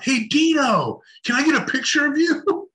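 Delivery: high-pitched call across the gallery; a photographer hailing him from afar; mock yell. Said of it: "yelled out".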